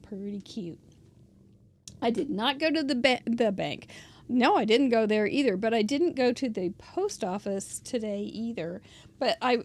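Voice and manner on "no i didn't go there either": ordinary talk, nothing marked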